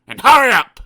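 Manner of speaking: loud, rude order; snapped. Acted. Mister Grumpy ordering the butcher about, loud and rude. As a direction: bark at the butcher. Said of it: "snapped Mister Grumpy".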